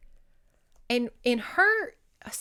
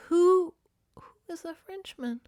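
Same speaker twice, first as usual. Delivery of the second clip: mock bafflement; 9 semitones higher than usual. Reader confused by the plot, mock bafflement.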